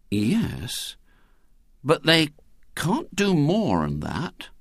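slow, deliberate, plotting; slow and scheming. A narrator's reading. William hints at his plan to the gang, slowly. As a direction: drag it out as the plan dawns. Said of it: "said William slowly".

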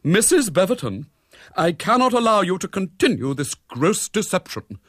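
high, loud, indignant reprimand; stern, high-pitched scolding; dramatic denunciation. A narrator's reading. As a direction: denounce her like an unruly choirboy. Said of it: "in dramatic denunciation".

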